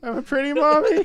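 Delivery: very high, loud silly voice. Pretend. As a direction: squeal it like a goofy doll.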